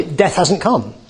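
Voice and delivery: normal talking voice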